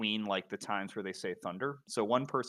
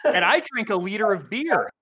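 proud boast; 11 semitones higher, 11 dB louder than usual. Real, not acted. Boasting about drinking.

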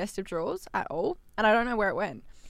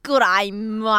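loud, overdone fake Australian accent; mock an American attempting Australian; hammed-up Australian drawl; bad Australian accent; 10 dB louder than usual. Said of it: "Americans try to do Australian accents".

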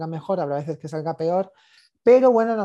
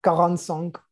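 put-on French accent. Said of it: "te encanta hablar con acento francés".

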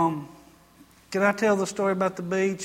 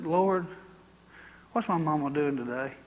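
quiet prayer; 3 semitones lower, 6 dB quieter than usual. Retold or imitated. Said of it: "Just like that".

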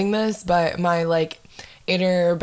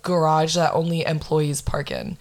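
normal talking voice

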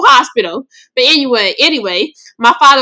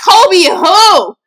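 disbelief; 10 semitones higher, 5 dB louder than usual. Retold or imitated. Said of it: "And I'm like".

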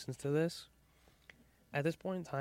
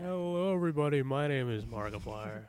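Markiplier impression; 4 dB louder than usual. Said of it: "That was actually pretty good".

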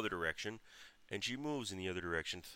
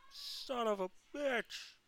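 slow, high falsetto wail of a curse; mock mom yell; much slower than usual. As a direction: draw out mom's high-pitched cursing. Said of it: "my mom from the porch yell".

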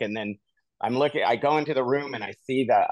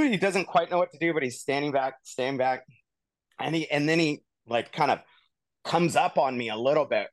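ordinary delivery

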